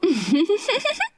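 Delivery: a high amused giggle; high laugh. A narrator's reading. Sister laughing at her brother, high giggle. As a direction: she laughs again at her own joke. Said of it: "वो एक बार फिर हंस पड़ी".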